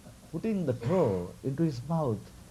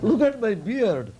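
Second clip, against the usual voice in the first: higher, mock-offended insistence; proud sage points to his beard, higher up; indignant sage; 8 semitones higher than usual. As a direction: demand he look, lightly hammed. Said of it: "Markandeya could not contain".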